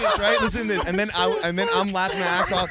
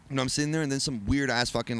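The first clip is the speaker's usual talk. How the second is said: ordinary talk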